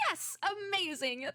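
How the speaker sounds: high, delighted reaction; excited. Acted. Noodle thanking the detective for the broom, excited. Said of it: "Noodle is so excited".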